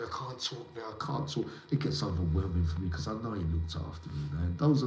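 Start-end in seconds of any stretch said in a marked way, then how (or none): 0.0-1.4 tearful, retold. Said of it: "I was in tears".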